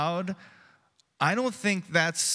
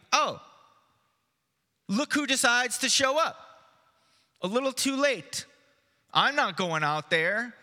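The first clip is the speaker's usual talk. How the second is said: higher, mock-sulky voice for Mary; sulky sarcasm; 5 semitones higher than usual. Pastor voicing hurt Mary scoffing at Jesus, mock-sulky. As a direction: voice her hurt, sarcastic jab. Mary's imagined sulky reply.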